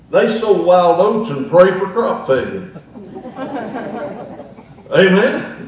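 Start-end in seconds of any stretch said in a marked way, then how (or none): none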